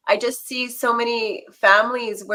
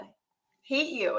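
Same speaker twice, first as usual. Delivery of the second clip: muttered under breath; 4 semitones higher, 5 dB quieter than usual. Retold or imitated. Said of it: "That, that muttering under your breath".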